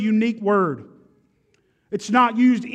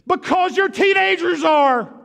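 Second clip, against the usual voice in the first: higher and louder, punching the answer; a loud retort from the pulpit; 7 semitones higher than usual. Acted. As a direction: fire back the answer to the doubter. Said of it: "why are you so loud".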